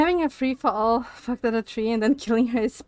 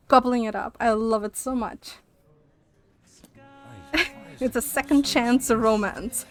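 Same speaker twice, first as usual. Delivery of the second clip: normal talking voice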